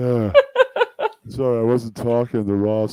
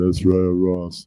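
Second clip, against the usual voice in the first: dim giant cyclops; 2 semitones lower, slower than usual. Retold or imitated. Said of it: "Nobody can fucking imitate that goddamn fucking giant voice".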